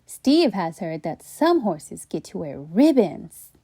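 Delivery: usual speaking voice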